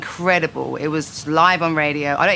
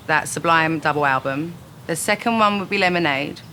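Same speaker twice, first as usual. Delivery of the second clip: normal talking voice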